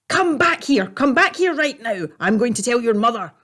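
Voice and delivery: high loud yell after a thief; shouting. Acted. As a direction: bellow it as you chase. Chasing thief, yelling.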